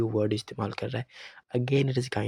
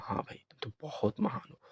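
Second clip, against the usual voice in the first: ironic agreement; 2 semitones higher, 6 dB quieter than usual. Shown as a demonstration. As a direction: agree with mock admiration, voice dropped softer. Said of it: "tone अलग हो गई, तो ये क्या कहलाता है? Irony". (व्यंग्य भरे लहजे में)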